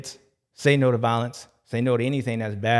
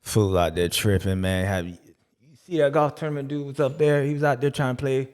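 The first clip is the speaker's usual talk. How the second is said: a hint of a lower, worn-out guy, lazy complaining; lightly voiced tired character; 3 semitones lower than usual. The caddy complains about Jay, a lower lazy grumble.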